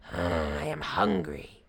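low, growled tiger voice; snarling tiger. A narrator's reading. Freed tiger eyeing his rescuer, snarling hungrily. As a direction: snarl it from deep down. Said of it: "he snarled".

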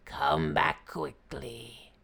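low, slow growled warning; warning tiger. A narrator's reading. Tiger warning the departing Brahmin, growling. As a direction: draw it out as a deep growl. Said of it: "warned the tiger".

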